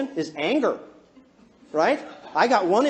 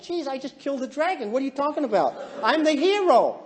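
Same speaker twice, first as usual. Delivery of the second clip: higher, protesting husband; mock indignation; 4 semitones higher than usual. Husband defending himself to unhappy wife, indignant protest.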